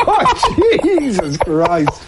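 high laughing "Oh", then slow "Jesus Christ"; laughing exclamation. Real, not acted. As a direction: laugh out in mock outrage. Reacting to nurse comment.

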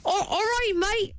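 high, put-on Australian; Australian accent. Co-host's try at the boss's accent, exaggerated Australian. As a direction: pitch up a hammy Aussie greeting. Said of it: "Are you for real?".

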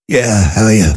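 put-on poorly phone voice; faked sick voice. Employee answering the boss on the phone, faked sick voice. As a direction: imitate a caller feigning illness. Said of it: "Why are you faking your voice?".

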